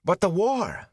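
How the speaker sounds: high, quick eager start. A narrator's reading. Boy interrupts the gleeman eagerly. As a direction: burst in eagerly. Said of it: "Mat began eagerly".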